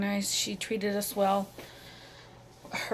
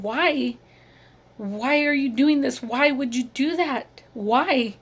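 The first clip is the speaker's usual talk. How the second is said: higher, louder retold plea of why; pleading whys, pitched up and pushed louder; distraught questioning; 4 semitones higher, 5 dB louder, slower than usual. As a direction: plead for an answer, reliving the shock. Mother retells questioning her son.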